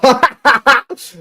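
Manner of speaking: a sudden high-pitched burst of laughter. Real, not acted. Laughs after saying it's calm.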